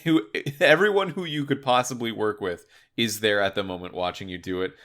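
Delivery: ordinary delivery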